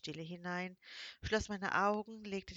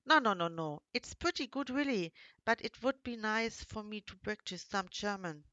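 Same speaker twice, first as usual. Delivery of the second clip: lightly voiced young man, eagerly reassuring, louder; friendly foreign man; 3 semitones higher, 6 dB louder than usual. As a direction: reassure her warmly in his English-accented voice. Male character reassures, English accent. (leicht gesprochener Engländer, der eifrig beruhigt)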